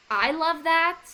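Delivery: light up on "I love that"; excited. Real, not acted. Coach gushing about a favourite rebuttal, excited.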